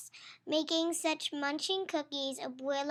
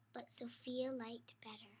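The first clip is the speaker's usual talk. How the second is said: hushed story line; whispering; 4 semitones lower, 11 dB quieter than usual. Acted. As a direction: whisper the punchline. End of rhyme whispered.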